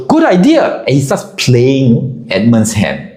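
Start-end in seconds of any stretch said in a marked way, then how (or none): 0.1-0.8 much higher, cheerful as Y; pitched-up mock of Y agreeing; imitating Y. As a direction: chirp it without a beat. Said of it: "Y goes".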